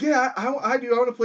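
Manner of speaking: loud, high eager answer; eager volunteering. Retold or imitated. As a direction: jump at the chance to play. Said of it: "And I'm like".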